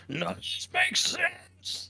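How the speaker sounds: very high, slow bewildered whine; high confused voice. Retold or imitated. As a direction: squeak it out, totally lost. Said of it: "and I was like".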